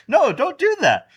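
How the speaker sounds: high, loud mock damsel plea; hammed-up helpless victim; protesting woman. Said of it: "She was like".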